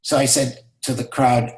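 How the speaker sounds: ordinary delivery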